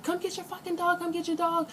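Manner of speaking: high, held-back mock holler; mock scream. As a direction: retell a scream without full volume. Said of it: "I'm screaming the whole time".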